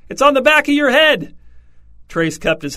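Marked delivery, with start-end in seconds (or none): 0.1-1.3 loud, very high-pitched alarm; shriek. A narrator's reading. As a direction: shriek an urgent warning. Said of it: "The woman shrieked".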